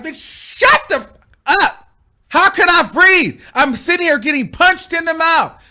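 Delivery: loud, high indignant yelling; retold mad outburst. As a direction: let rip in exasperated mock fury. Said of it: "I'm getting more mad".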